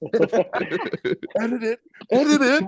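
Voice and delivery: laughing. Real, not acted. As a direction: squeal it through laughter. Teasing about editing the recording.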